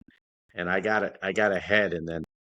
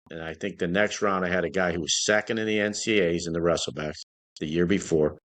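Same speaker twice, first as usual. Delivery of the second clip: ordinary delivery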